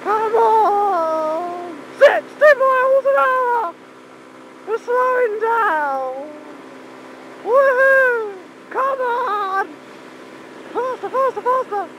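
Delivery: high, whooping cheer; excited urging. Real, not acted. Rider urging a slowing bike on, high excited calls.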